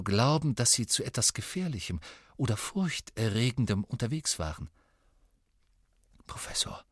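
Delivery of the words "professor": whispered under his breath; a hushed, breathless call; quietly. A narrator's reading. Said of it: "sagte Harry leise".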